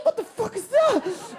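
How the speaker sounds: high, slow baffled cry; be spooked by the noise in the water; baffled shout. Acted. Confused shout.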